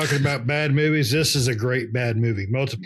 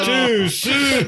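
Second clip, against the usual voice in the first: pitched-up mock reproach; mock scolding; 10 semitones higher than usual. Real, not acted. Repeating the protest to his friend, high mock scolding.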